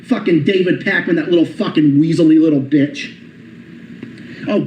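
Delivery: usual speaking voice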